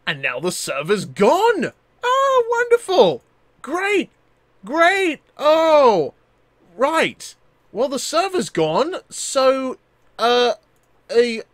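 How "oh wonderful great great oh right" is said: sarcastic. Real, not acted. Server crashed, sarcastic.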